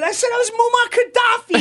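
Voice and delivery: very high, loud disbelief; almost shrieking at how dumb it was; incredulous shout. Real, not acted. Comic recalling his prank to the hosts, high incredulous shout.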